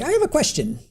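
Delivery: a hint of a strange, prim lawyer asking; bizarre lawyer voice. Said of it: "her demeanor was very, very bizarre".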